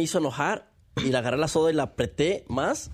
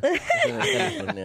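much higher, laughing through the words; laughing repeat. Real, not acted. Repeating the place to friends while laughing hard. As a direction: repeat it cracking up in a high voice.

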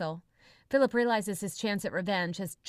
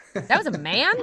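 surprised blurt; 4 semitones higher, 8 dB louder than usual. Acted. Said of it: "Dex blurts out".

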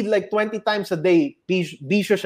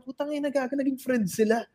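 hushed aside; 5 semitones higher, 8 dB quieter than usual. Retold or imitated. Friends muttering on the side.

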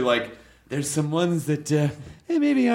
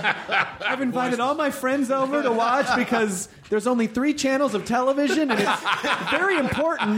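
put-on pitched-up prim young girl; little girl voice; 9 semitones higher than usual. Voicing the child girl character.

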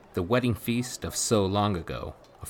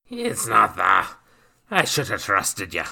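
humbled sailor; 3 semitones higher, 8 dB louder than usual. Acted. Captain's sheepish reply.

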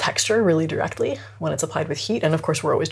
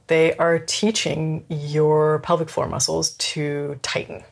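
ordinary talk

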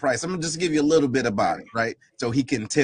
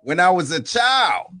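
rising impassioned oratory, building louder; preacher's voice; 7 semitones higher, 3 dB louder than usual. Pretend. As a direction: preach it like a Sunday sermon, building up. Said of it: "put on my, my preacher's voice".

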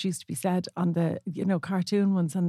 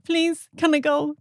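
higher, drawn-out mock plea; pleading child; 8 semitones higher, slower than usual. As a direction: beg dad like a kid. Retelling childhood begging to dad.

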